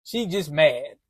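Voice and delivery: lightly voicing his laid-back mother; a son imitating his mom dismissing the pregnancy in a low, soft voice; mom's voice. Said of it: "She said".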